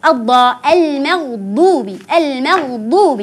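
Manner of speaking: المغضوب drawn out slowly and clearly; slow careful reading. Shown as a demonstration. Teacher models المغضوب slowly for the children. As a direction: read المغضوب slowly, each sound clear. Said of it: "بهذه الطريقة أقرأها".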